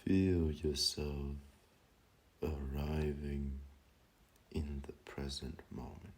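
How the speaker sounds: very slow, drawn-out meditation guidance; guided meditation. Acted. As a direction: guide them gently into stillness, stretching each phrase with long pauses. Leads a meditation.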